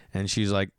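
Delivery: usual speaking voice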